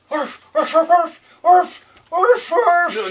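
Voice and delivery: loud, high yappy barks; dog barking. Acted. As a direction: bark like an excited dog. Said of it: "Dog Day Afternoon".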